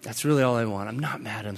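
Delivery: low, softened talk to himself; retold moment of settling down, tender and quiet; calming down. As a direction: talk yourself down gently. Retold calming self-talk after frustration.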